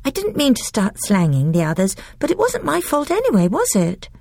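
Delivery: put-on high, quick, defensive little girl; a child excusing herself in a rush; young girl Lucy. A narrator's reading. Voices Lucy.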